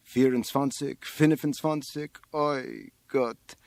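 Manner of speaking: lightly voiced muttering counter; counting janitor in Yiddish. A narrator's reading. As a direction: count heads, then a mild lament.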